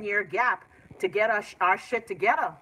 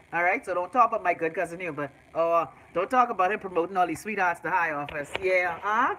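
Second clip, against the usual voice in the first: ordinary talk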